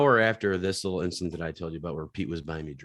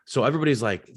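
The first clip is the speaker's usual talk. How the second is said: usual speaking voice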